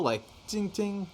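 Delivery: high-pitched ting ting bell imitation; high mock telephone ting; imitated ring. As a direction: chime it like an old switchboard bell. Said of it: "Remember those old telephone people".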